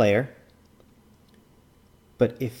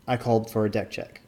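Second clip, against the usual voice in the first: ordinary delivery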